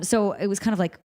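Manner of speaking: ordinary delivery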